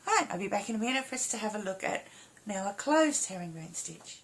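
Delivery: ordinary talk, nothing marked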